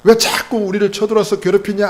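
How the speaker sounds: loud, high grumbling protest; acted indignant whine; mock complaint. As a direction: complain as the aggrieved people. Voices the people's complaint.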